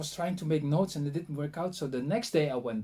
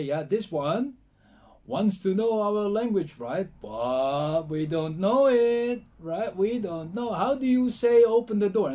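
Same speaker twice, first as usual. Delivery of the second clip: put-on loud, higher-pitched, drawn-out drunken talk; drunk villager; 6 semitones higher, 8 dB louder, slower than usual. Drunk villager loudly telling the others about the visitor, drawn-out and high. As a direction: imitate a drunk man holding forth, slow and loud. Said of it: "were totally drunk".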